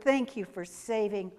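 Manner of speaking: ordinary delivery, nothing marked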